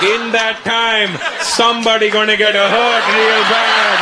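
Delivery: put-on accented father, measured threat; menacing dad. Dad answers his son's threat, deliberate and menacing. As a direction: play dad calmly calling the bluff, stretching the final warning. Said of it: "That was my dad's threat".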